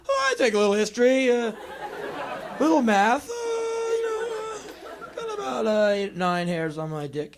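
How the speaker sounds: high, nervous, strained filler; awkward small talk. Acted. Boy with nothing to say to a girl, high and awkward.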